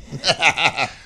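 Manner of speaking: loud burst of laughter; real, loud self-amused laugh. Real, not acted. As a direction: laugh out loud at your own joke. Laughs at his own joke.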